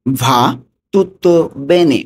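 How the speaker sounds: slow teaching repeat. Shown as a demonstration. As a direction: spell out "Va tutto bene" slowly for students. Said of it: "এটার Italian কি হবে".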